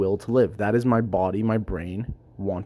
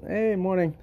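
higher, friendly hey morning; real greeting to a coworker; 8 semitones higher than usual. Real, not acted. Mid-recording, greeting a coworker at work, higher friendly hello. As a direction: call a quick hello to a passing coworker.

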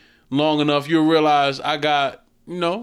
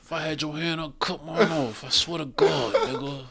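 through gritted teeth; 7 dB quieter than usual. Retold or imitated. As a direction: speak through clenched teeth, intense and quiet. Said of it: "Started talking through his teeth".

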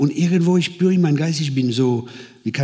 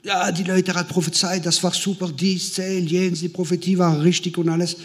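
high-pitched put-on gushing; gushing friend; 7 semitones higher than usual. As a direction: imitate a friend insisting it was great, pitched high. Imitates friend's enthusiastic protest. (hohe, nachgeahmte Schwärmerei)

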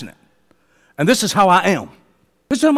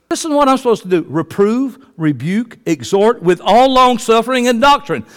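usual speaking voice

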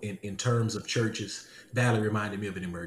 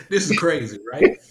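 higher, louder, laughing disbelief; 6 semitones higher, 7 dB louder than usual. Real, not acted. Man telling his own conversion story, laughing. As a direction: say it through real laughter.

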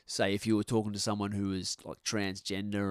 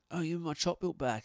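higher-pitched, a hint of a pestering schoolkid; retold playground protest, pitched up; lightly mimicked schoolkid plea; 8 semitones higher than usual. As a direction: lightly act out a kid wanting it back.

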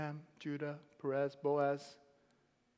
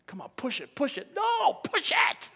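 gym coach; 9 semitones higher, 6 dB louder than usual. Pretend. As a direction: pretend trainer shouting push it, high and loud. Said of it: "I feel like I'm training you at the gym".

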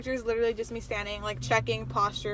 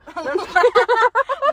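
loud laugh; 11 semitones higher, 10 dB louder than usual. Real, not acted. Friend's loud laughing burst.

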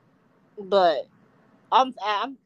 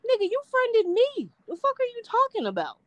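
much higher, mock-outraged disbelief; incredulous outburst. To a guy who friended him again, high incredulous outrage. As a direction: throw it back at him, pitched way up in disbelief. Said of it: "I was like".

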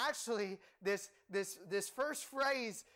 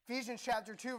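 ordinary talk

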